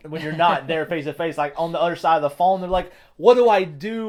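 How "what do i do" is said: high, loud cry of helplessness; mock panic. Imagined player on the other side of the phone, loud mock panic. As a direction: act out a player at a total loss.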